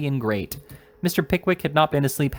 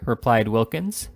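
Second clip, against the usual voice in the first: plain reading voice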